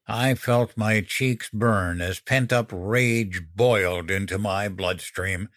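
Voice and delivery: ordinary delivery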